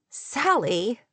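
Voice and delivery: mild exclamation, rising at the end; a touch of protest in calling out to someone; exclaiming. A narrator's reading. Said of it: "Kate exclaimed".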